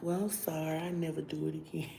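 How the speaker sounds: very soft, insincere little apology; hushed, sly mock contrition; smiling fake apology. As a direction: say sorry meekly through a smile. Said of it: "I'm still smiling".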